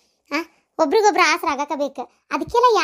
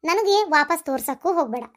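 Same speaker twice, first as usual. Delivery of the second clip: normal talking voice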